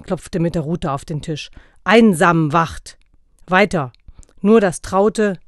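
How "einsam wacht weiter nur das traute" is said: loud, slow, stern correction. A narrator's reading. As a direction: bark each word out like a drill master. Santa corrects, bangs the rod.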